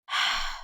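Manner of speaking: soft weary exhale; tired sigh. A narrator's reading. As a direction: sigh as you get home. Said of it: "وقال بتنهيدة وتعب". (تنهيدة تعب)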